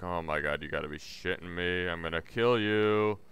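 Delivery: muttered exasperation. Retold or imitated. Inner exasperated reaction.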